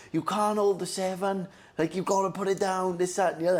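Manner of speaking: put-on high, whining kid protest; mock-crying complaint; whiny crying kid. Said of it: "we were crying".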